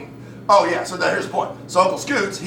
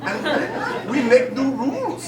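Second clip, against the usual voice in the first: put-on high-pitched German-accented relatives; slight German accent; 11 semitones higher than usual. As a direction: lay out rules in a high, earnest German accent. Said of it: "slightly a German accent".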